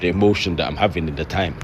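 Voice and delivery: very low, expressionless monotone; emotionless low voice. Shown as a demonstration. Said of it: "this don't really express the emotion".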